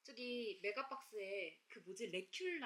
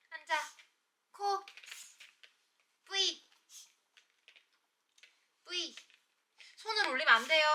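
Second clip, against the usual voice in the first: loud dog commands; 6 semitones higher, 12 dB louder than usual. Real, not acted.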